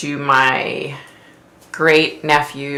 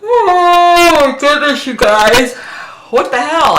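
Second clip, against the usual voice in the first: high loud exclamation; 11 semitones higher, 7 dB louder, slower than usual. Real, not acted. Starts yawning on the live.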